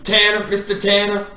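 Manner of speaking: high, lightly voiced Carrie calling a name; imitating Carrie. Tanner mimicking how Carrie greets him, lightly voiced. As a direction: call the name out like the old neighbor. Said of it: "That's what he says".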